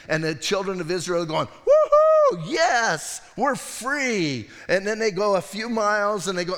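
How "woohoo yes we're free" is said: high whooping cheer of joy; shrill-free joyful whoop; excited cheer. Retold or imitated. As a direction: cheer like freed slaves. Israelites celebrating freedom.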